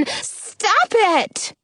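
high, exasperated cry; protesting yell. A narrator's reading. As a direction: cry out to fend off an attack. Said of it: "I yell as the pigeon aims".

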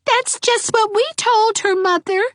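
put-on high children's voices; chiming in together like smug twins; high, childish chorus. A narrator's reading. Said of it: "chorused the twins".

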